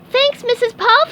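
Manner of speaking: very high, loud cheery holler; squeaky happy shout. A narrator's reading. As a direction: call back while dashing out. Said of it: "shouted SpongeBob".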